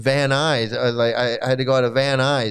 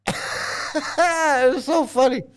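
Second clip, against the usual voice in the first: laughing through words; 10 semitones higher, much slower than usual. Real, not acted. Laughing recalling the worm.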